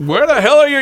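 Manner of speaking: high, loud gruff yell; impression of Louis Cardinal. Uncle yelling at his wife about her teeth. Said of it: "like Louis Cardinal".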